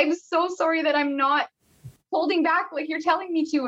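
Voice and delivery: high-pitched mock pleading; retold strained apology. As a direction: apologize high up, imitating yourself mid-labour. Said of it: "I'm screaming, and I'm also saying sorry".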